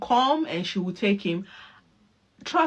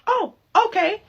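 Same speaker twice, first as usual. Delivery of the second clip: high, loud mock-disappointed oh; mock dismay; 13 semitones higher, 4 dB louder than usual. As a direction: fake a cheerful okay over letdown. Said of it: "And I'll be like".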